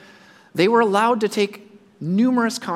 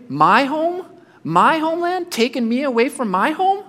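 indignant refusal; 3 semitones higher than usual. Retold or imitated. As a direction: protest like an exile asked to bless his captors. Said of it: "I'm thinking".

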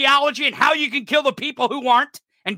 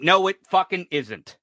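low, held-down rebuttal; restrained irritation, pitched well down; firm contradiction; 7 semitones lower than usual. Real, not acted. First rebuttal, then repeated forcefully.

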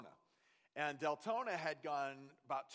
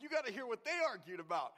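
high, fast excited; eager excited; 7 semitones higher, 4 dB louder, faster than usual. Retold or imitated. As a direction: rush it out eagerly. Son voiced, eager.

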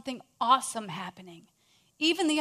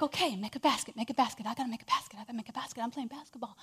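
muttered pep talk, fading to a whisper; softer and softer, ending unvoiced; self-talk fading to whisper; 3 semitones lower, 7 dB quieter, faster than usual. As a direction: coach yourself under your breath until it's barely there. [name] psyching himself up.

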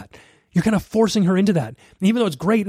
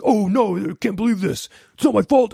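mock rage fit; 4 semitones higher than usual. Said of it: "you're gonna go into a rage fit".

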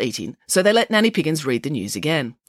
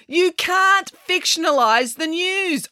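high, very slow, appalled objection; scandalised news director; arguing; 14 semitones higher, much slower than usual. Acted. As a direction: stretch the words in exasperation. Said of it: "argued the news director".

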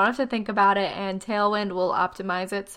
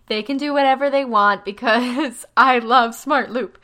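laughing; 3 semitones higher, 6 dB louder than usual. Real, not acted. Gushing about the new feature.

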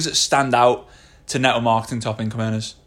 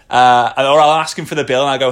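ordinary talk

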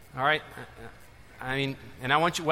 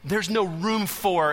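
slow, louder mock snarl; retold snarl; 4 semitones higher, 5 dB louder, much slower than usual. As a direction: lean on each word like a hostile elder. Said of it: "the church elder snarled at him".

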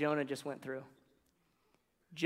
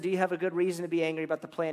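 fast, hurried question; rushed God; 5 dB louder, faster than usual. Retold or imitated. God quickly asking Jonah again, rushed. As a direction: rattle it off. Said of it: "So the Lord said".

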